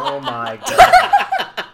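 very high, loud, drawn-out laughing cry; laughing exclamation. Real, not acted. Host reacting to a friend's joke, high helpless laughter. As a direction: stretch it out while cracking up.

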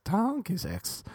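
high, slow braying on "Donkey sex"; hammed-up donkey bray on "Donkey sex". Shown as a demonstration. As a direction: stretch "Donkey" out like a donkey's hee-haw. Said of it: "I sounded like a donkey there when I said donkey sex".

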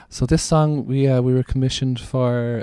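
lightly mocking earnest band on stage; mock band announcement. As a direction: ham up a band's earnest song intro. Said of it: "different genres say".

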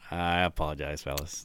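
low, very soft retold apology; meek apology. Podcaster apologizing to coworkers over his show, meek. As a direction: back down quietly, deflated.

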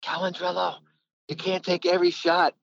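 a high mock coach voice, pitched well up; a high, hammed-up coach calling him out for shooting too much; imitating the coach. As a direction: ham up the coach telling him off. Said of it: "he used to tell me".

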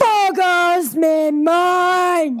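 slow, high drawn-out holler; hammed-up catchphrase. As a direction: bellow mock amazement, stretching every word. Echoing the catchphrase loudly.

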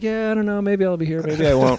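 high, shrugging, can't-be-bothered; mock indifferent. Contractor brushing off the host, mock indifferent. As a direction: toss it off like you don't care.

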